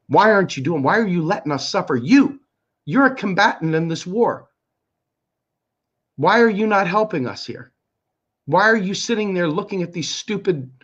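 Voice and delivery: heated, pleading outrage; pissed off. Real, not acted. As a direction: fire accusing questions, really angry. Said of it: "yeah, and I get pissed".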